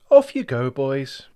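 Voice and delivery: put-on high, loud calling to the boys. Mum calling the boys off to school, high and loud. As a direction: call them out of the door brightly.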